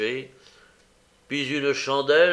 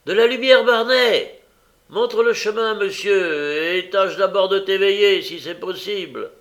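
high, loud bawling at a sleepy servant; raised commanding shout, easing at the end; shouted orders; 9 semitones higher, 7 dB louder than usual. A narrator's reading. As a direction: call impatiently through the house.

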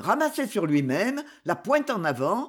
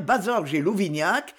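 usual speaking voice

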